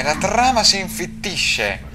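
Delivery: ordinary talk, nothing marked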